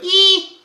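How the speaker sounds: very high, loud squeaked "ee"; piercing little high squeal; high squeal without grain. Shown as a demonstration. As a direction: squeak it straight up high, no gravel at all. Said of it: "it's just up high, it's like".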